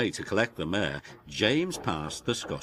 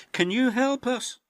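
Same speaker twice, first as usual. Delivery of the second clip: pleading engine; 3 dB louder than usual. Acted. Engines asking James for help.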